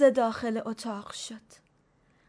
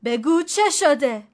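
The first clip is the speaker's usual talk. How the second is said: louder, slow stern demand, punching چه; sharp rebuke; 3 semitones higher, 9 dB louder than usual. A narrator's reading. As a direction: snap at her to tell him. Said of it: "فریدون تشر زد".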